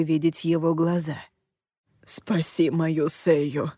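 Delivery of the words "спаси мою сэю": slow urgent whisper; fervent whisper. A narrator's reading. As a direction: beg in a hushed whisper. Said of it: "горячо зашептал молодой мужчина".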